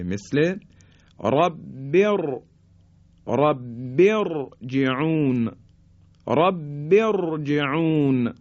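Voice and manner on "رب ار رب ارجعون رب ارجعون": slow "رب ارجعون", resting on a thick R; heavy R. Shown as a demonstration. («رب ارجعون» آهسته، با رای ساکن درشت)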